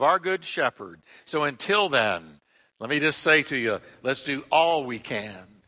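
slow, swelling sermon close; preaching at full force. Acted. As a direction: bring the sermon home. Closing preaching over music.

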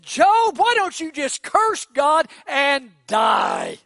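high, loud, slow shout at her husband; Job's wife shouting. Acted. Job's wife screaming at suffering Job, high loud shout. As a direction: yell "Job" way up high, then drag the curse out. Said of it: "Job's wife came to Job and said".

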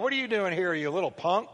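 usual speaking voice

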